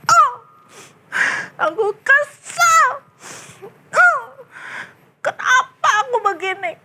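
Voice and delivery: high, tearful whining complaint; acted crying. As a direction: whimper it like an upset kid. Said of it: "nangis dia". (merengek sambil menangis)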